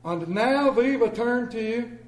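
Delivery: pastor interrupted. Retold or imitated. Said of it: "And he looks down".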